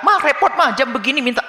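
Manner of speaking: high, irritated complaint; annoyed child whining. Retold or imitated.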